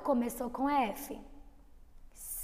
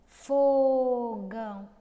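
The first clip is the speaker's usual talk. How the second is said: drawn-out demo word; 6 dB louder, much slower than usual. Shown as a demonstration. Teacher repeating Fogão so pupils hear the F. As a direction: draw out Fogão again, fo held. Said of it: "E fogão?".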